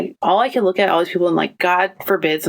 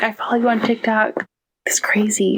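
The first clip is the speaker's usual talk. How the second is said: whisper; 5 semitones higher than usual. Retold or imitated. Tech whispering repeated.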